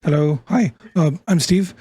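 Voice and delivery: a slight real voice crack. Real, not acted. Player introducing himself, voice cracking. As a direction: let the voice crack on the greeting. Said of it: "the voice crack immediately".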